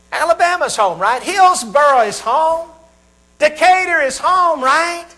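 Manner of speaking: high-pitched, drawn-out place names; eager hometown answer. Acted. Voicing the audience's hometown answers.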